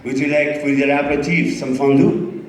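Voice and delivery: hammed-up French waiter offering fondue; a hint of a French maître d'; mock French waiter. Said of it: "it could be French".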